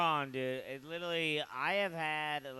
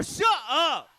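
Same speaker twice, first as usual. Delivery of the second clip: loud, high yelled Shut up; retold shout; 9 semitones higher, 9 dB louder than usual. Comic telling his date to stop, loud shout. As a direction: bark it out like a cornered guy. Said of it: "I go".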